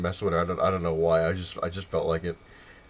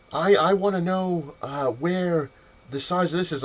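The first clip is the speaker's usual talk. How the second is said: slow, high-pitched careful request; imitated frustrated customer; 8 semitones higher, slower than usual. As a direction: spell it out haltingly for the clerk. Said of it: "can you please do a little slower".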